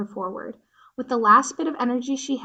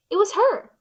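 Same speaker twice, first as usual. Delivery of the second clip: higher, louder sudden discovery; excited; 11 semitones higher, 6 dB louder than usual. A narrator's reading. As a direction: burst out in surprise. Bia spots bee figure.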